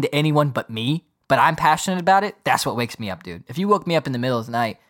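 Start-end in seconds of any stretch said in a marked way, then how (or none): none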